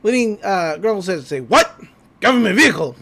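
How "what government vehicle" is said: loud, startled mock reaction; alarmed outburst. Recapping Grunkle hearing of a government car, alarmed outburst. Grunkle panics at word of agents.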